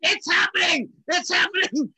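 high, giddy re-enacted celebration; thrilled outburst. Drummer calling a friend with the big news, thrilled. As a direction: blurt it out high and loud, overjoyed.

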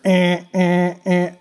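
a quick buzzing eh eh eh like an alarm going off; vocal fire alarm beeps; imitated fire alarm. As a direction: mimic the alarm's beeping with the voice. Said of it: "you just heard".

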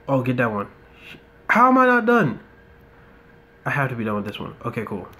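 ordinary talk, nothing marked

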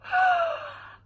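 very high sharp gasp; shocked gasp. Real, not acted. Reviewer stunned by Galen's line, high gasp.

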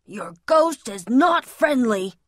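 plain reading voice

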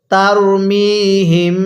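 তারমিহিম drawn out slowly through the nose; recitation with ghunnah. Shown as a demonstration. Teacher leading students in nasal recitation, slow. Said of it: "নাকের বাসি দ্বারা গুন্নাহ করবেন".

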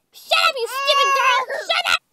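high, loud furious outburst; angry shouting. Acted. To the child calling Todd, angry shouting.

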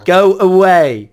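loud slow shout; drawn-out yell to leave. Real, not acted. Shooing off a teasing player.

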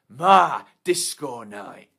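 loud and slow; possessive roar. Acted. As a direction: claim it as yours.

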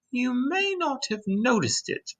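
high-pitched, gentle lady's voice; kind ladybug voice. A narrator's reading. Ladybug standing beside James to explain, kind high voice. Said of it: "the ladybug went on".

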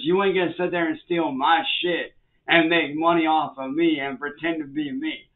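usual speaking voice

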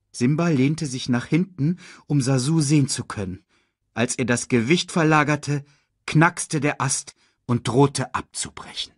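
ordinary delivery, nothing marked

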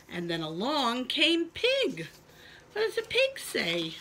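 plain reading voice

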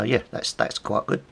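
ordinary talk, nothing marked

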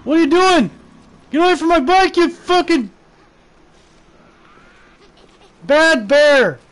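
loud high furious shouting; angry yelling. Real, not acted. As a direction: scream at the bear to leave. Bear attacking his bike.

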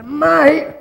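high, loud outraged yell; a sudden blokey shout of protest; exclaimed mate. Acted. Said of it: "so you go".

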